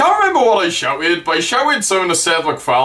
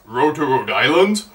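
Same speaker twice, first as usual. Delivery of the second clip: lower, querying repeat with rising end; questioning echo; 4 semitones lower than usual. Retold or imitated. Seth replying to a heckler, questioning echo. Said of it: "And he says".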